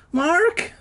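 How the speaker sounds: sudden high startled cry. A narrator's reading. Boy bursting in cries out to his mom, startled. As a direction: jolt up and cry his name. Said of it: "uttered a startled cry".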